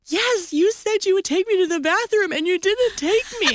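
high-pitched hammed-up crying accusation; mock tearful complaint. Wife blaming husband, imitated crying. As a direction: wail it out like a wronged child. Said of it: "I start crying loudly".

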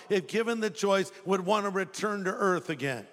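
normal talking voice

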